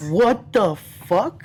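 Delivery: slow, stretched, high-pitched incredulous question; retold disbelief. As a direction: draw it out high in stunned disbelief. Retold shock at the huge fee.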